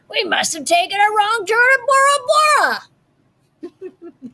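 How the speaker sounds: pirate voice. A narrator's reading. Pirate speaking.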